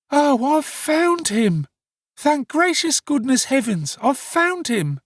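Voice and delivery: put-on high, drawn-out wailing young woman; hysterical exclamations. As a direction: lament loudly, as if overcome at finding him. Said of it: "With these incoherent exclamations".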